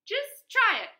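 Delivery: high, very loud dare; defiant shout. A narrator's reading. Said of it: "shouted the third little javelina".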